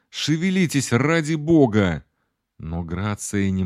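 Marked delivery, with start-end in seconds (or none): 0.1-2.0 higher-pitched urgent plea, not full volume; pressing, raised-pitch exclamation; restrained yell. A narrator's reading. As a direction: urge them on in a contained narrated cry. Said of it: "заорал я".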